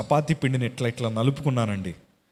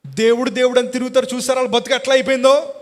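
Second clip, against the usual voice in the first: much higher, louder and fast sneer; mocking taunt; 11 semitones higher, 6 dB louder, faster than usual. People mocking the family's faith, loud fast sneer. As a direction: taunt the believer scornfully. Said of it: "కొంతమంది వచ్చి అన్నారు".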